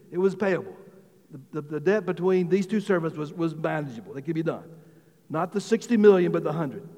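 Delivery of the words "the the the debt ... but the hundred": normal talking voice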